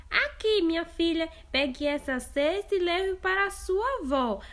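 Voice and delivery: put-on a mother sending her girl off; mother's voice.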